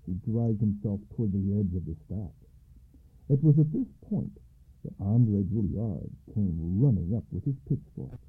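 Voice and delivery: ordinary delivery, nothing marked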